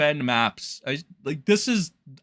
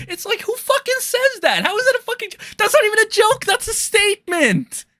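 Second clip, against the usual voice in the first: incredulous yell. Real, not acted. Disbelief at quoted tweet.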